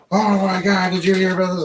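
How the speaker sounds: high, loud news-shock imitation; hammed-up excited gossip, pitched high; mock alarmed father. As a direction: mimic a dad worked up over the news. Mimics father relaying the news.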